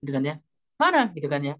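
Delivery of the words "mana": sharp demand. Retold or imitated. Wife searching, retold confrontation.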